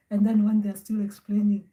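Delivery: ordinary talk, nothing marked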